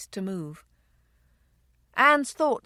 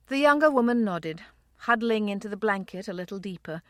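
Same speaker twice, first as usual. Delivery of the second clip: ordinary delivery, nothing marked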